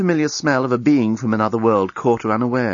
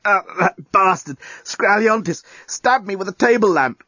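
growled; 8 semitones higher than usual. A narrator's reading. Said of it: "growled the axe murderer".

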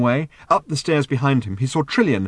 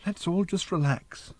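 slow, soft, higher voice; gentle calming; 8 semitones higher, 5 dB quieter than usual. A narrator's reading. Arthur calming a tense standoff, gently. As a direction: ease everyone down slowly.

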